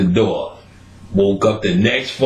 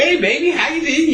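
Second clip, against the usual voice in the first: put-on much higher, syrupy hey baby; high sing-song hello to a lover; sweet falsetto greeting. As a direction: coo it like a doting partner. Partner greeting, voiced in falsetto.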